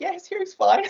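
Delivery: high, mocking explanation; exasperated correction. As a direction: set her straight with a laugh.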